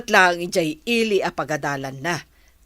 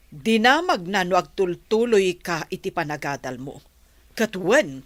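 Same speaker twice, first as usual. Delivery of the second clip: ordinary talk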